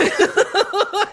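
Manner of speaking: loud, high embarrassed laugh; high burst of laughter. Real, not acted. Remembering dad's awkward hug, laughing hard. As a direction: crack up at the memory.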